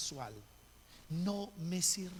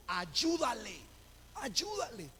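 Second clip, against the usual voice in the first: voz del sueño; 3 semitones higher, slower than usual. Retold or imitated. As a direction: imitate a mysterious voice calling in a dream. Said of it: "escuchó una voz que le dijo". (una voz que llama en sueños)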